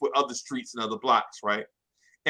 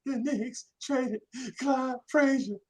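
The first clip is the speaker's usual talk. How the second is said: high, slow, strained crying line; choked out; 7 semitones higher, 5 dB quieter, slower than usual. Retold or imitated. Boy repeats the trade news to his friend, choking up. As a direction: force the words out through tears. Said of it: "and I choke it out".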